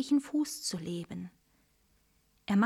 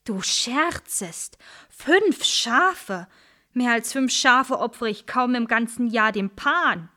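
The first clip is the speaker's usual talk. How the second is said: indignant outcry; 3 semitones higher, 5 dB louder than usual. Acted. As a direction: scoff in loud disbelief. Shepherd indignant at offer.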